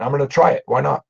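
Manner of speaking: ordinary talk, nothing marked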